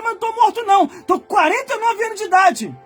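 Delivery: usual speaking voice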